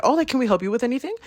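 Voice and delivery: high, quick customer-service imitation; shop assistant. Voices shop guy offering help.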